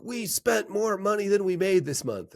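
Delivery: mock-grumpy husband moaning. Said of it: "I'd be so grumpy about it".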